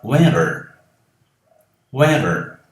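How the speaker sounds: "winter" with the T tapped like a D; American tap on "winter"; flapped T. Shown as a demonstration. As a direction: say "Winter" as "winder". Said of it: "eu diria".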